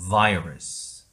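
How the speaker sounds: Virus said as vai-rus, stressed first syllable; slow clear Virus with the vowel between 어 and 아; model word virus. Shown as a demonstration. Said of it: "해보겠습니다". (천천히 또박또박 Virus 시범 발음)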